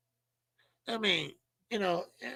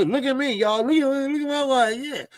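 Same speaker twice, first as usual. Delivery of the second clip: mock show-off; 7 semitones higher than usual. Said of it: "and so".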